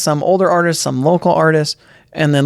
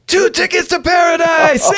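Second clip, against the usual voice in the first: [name] impression; 12 semitones higher than usual. Rock star announcing his hit to the crowd, high shout. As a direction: belt the song title like a cheesy rocker. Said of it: "and he's like".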